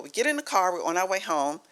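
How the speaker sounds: ordinary talk, nothing marked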